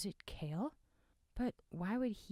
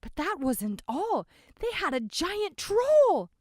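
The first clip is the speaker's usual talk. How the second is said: put-on frightened young man; 13 dB louder than usual.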